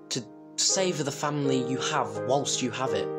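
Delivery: ordinary delivery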